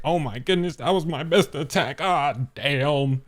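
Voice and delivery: hammed-up exasperated lament; Big Mom. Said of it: "she's like".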